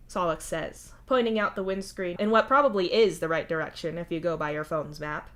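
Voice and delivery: ordinary delivery, nothing marked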